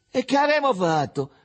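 put-on high-pitched small boy's question; high little-kid voice, speaking up; littlest boy. A narrator's reading. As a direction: pipe up as the youngest one. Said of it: "essendo più piccolino".

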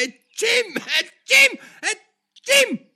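loud, very high acted sneezes; sneezing. A narrator's reading. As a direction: burst out in squealed sneezes. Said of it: "all'improvviso a starnutire". (starnuti acuti e fragorosi)

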